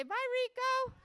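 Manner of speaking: high-pitched group farewell call; calling goodbye. Shown as a demonstration. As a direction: lead the kids in a big goodbye. Leads children in farewell.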